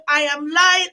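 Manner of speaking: usual speaking voice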